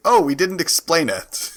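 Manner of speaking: ordinary delivery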